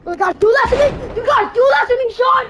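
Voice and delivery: high, very loud exasperated wail; anguished yell. Real, not acted. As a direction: scream it at the screen. Repeat, escalating.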